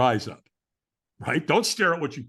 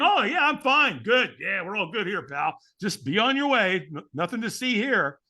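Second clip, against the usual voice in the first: fake-fine brush-off; 5 semitones higher than usual. Firewalker lying to the stranger who asks, fake-fine brush-off. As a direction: insist everything is fine, a bit too much. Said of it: "we lie ... We say".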